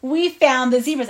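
loud, emphatic storytelling payoff; excited reveal. Real, not acted. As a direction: announce the big discovery. Storyteller reveals zebras loudly.

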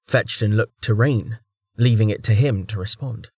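ordinary delivery

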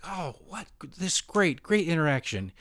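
delighted inner voice. Retold or imitated. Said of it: "I'm like".

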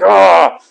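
pumped-up wordless yell; re-enacted adrenaline yell. Man pumped with adrenaline shaking his blanket, wild yell.

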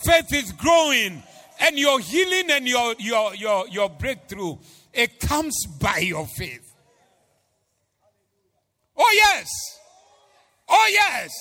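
fervent preacher climax; preaching at full force. Acted. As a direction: drive each line harder. Preaching climax, crowd call.

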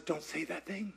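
very soft, low hush; hushed God voice. Retold or imitated. God gently repeating the warning, hushed and low. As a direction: barely breathe God's warning.

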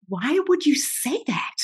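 high, slow, incredulous question; stunned suspicion. Retold or imitated. Actress hearing co-star name her secret valley, stunned. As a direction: draw it out in wary disbelief. Retelling shock at secret place named.

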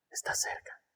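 soft unvoiced whisper. Shown as a demonstration. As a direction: whisper it close to the ear. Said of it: "No necesita gritar".

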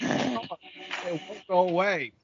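in pain from hot chili; mouth burning from spicy food. Real, not acted. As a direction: talk through a burning mouth. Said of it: "my nose is running like crazy now".

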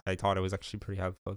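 ordinary talk, nothing marked

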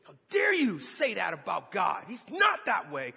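loud, outraged rebuke; angry. Retold or imitated. Angry man rebuking the preacher about God, outraged. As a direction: lash out in righteous anger. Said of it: "just angry".

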